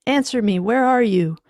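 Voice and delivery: alarmed shout; anxious demand. Acted. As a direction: call out urgently for an answer. Demanding answer from silent wife.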